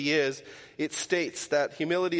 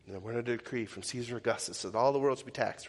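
fast, lower, softer rote recital; neutral mode; 6 semitones lower, 5 dB quieter, faster than usual. Shown as a demonstration. Pastor showing how he reads Luke two: bored rote recital. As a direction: rattle it off like a verse you know by heart. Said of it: "I kick into this neutral mode".

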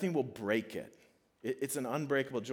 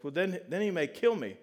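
higher-pitched mock dread; retold fretting, pitched up; imitated worried child; 6 semitones higher, 4 dB louder than usual. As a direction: play a kid talking himself into doom. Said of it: "he's just worried".

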